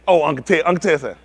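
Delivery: usual speaking voice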